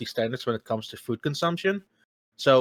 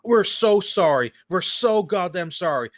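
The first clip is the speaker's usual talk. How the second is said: higher, emphatic retold apology; profusely apologetic; 6 semitones higher than usual. Worker apologizing to customer over cold nuggets, earnest higher voice. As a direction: lean hard on each 'so', pleading pardon. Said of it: "He was actually sorry. Like, he apologized".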